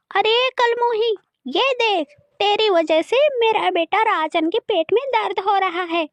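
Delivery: high-pitched, accusing rebuke; scolding. Acted. Stepmother blames the girl for the bellyache, scolding. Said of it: "Meenakshi देवी रानी को भला बुरा बोल रही थी".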